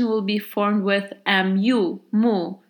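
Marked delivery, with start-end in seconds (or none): none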